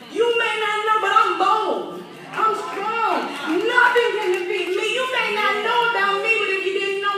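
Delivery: loud, rising preacher's cry of faith; preaching. Acted. Preacher boasting as David to the congregation, loud. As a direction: preach it with full force, building.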